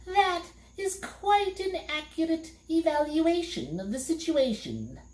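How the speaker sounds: high, cool and detached; a chilly, high-pitched verdict; cold voice. A narrator's reading. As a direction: state it coldly from above. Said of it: "said the voice coldly".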